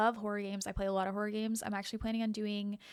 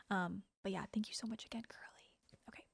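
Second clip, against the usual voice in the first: soft stream whisper; 7 dB quieter than usual. Real, not acted. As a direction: whisper it so the game stays heard. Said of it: "I wanna whisper all the time".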